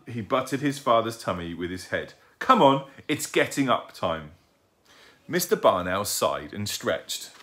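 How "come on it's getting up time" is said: put-on high, loud child's protest; insistent young owlet. A narrator's reading. Owlet catching out his sleeping father, insistent. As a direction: pipe it up high, accusing.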